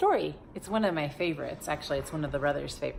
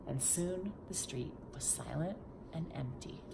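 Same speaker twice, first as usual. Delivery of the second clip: hushed whisper for the silent street; soft storytelling whisper; 10 dB quieter than usual. Acted.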